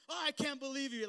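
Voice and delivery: hammed-up indignant cry at his wife; mock outrage. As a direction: act offended and betrayed.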